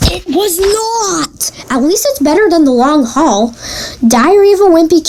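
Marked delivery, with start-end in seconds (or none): 0.1-1.5 high, slow strained squeal, held back in volume; hammed-up squeaky indignation; mock outrage. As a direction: squeeze out a drawn-out high protest. Acted outrage at disappointing book.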